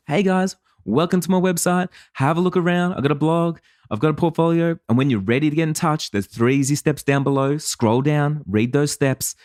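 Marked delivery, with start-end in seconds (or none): 0.1-4.8 higher, upbeat casual greeting to the camera; friendly website welcome video. Shown as a demonstration. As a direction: chat into your iPhone like you're greeting a visitor. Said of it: "me talking to the phone".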